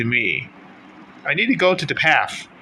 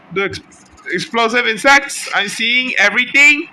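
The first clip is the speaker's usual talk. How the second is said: excited; 9 semitones higher, 5 dB louder than usual. Real, not acted. Excited gaming reaction.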